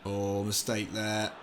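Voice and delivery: ordinary talk, nothing marked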